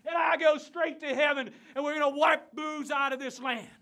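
building from loud to high, shouted frenzy; fiery tirade. Acted. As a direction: rail against sin, climbing with every threat. Preacher rails against booze.

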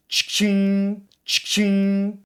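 chirpy vocal effect, tongue-made; mouth sound effect. Pretend. Gamer doing a sound effect for viewers with his mouth, chirpy. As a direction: make the game's sound with your mouth. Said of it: "Ağzımda da efekt veriyorum ha size".

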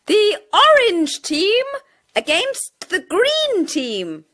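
high announcing voice; teacher calling out the teams. A narrator's reading. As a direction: present both teams. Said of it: "the teacher screamed loudly".

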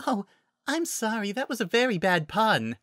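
high, gentle little voice; shy, mild and high-pitched; apologetic Fluttershy. Acted.

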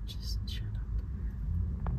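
slow hissed whisper; whispered hush. Real, not acted. Meg hushes him.

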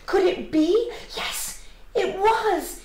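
high, loud, building wonder; excited discovery. A narrator's reading. Narrator reveals the long-sought pebble, excited. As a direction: build slowly to a thrilled reveal.

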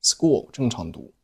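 normal talking voice

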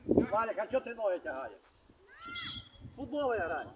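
ordinary talk

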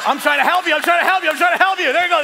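high, loud, laughing repeat; dad laughing at his kid, high and loud; laughing loud insistence. Retold or imitated. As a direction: laugh while insisting over and over. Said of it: "dad to kid, laughing".

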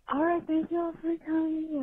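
high, quavering mock old lady; imitating frail grandma. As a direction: play the sweet frail grandma toasting the table. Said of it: "just yelling".

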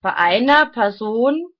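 deliberate, declaimed phrases, each set apart; reading aloud. Real, not acted. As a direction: read your written thoughts out like solemn maxims. Reads own written thoughts aloud.

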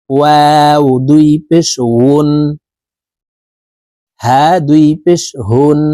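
slow, drawn-out Quran letter recitation; slow recitation drill. Shown as a demonstration. Teacher drilling students in slow tanween recitation. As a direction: chant each letter slowly for students.

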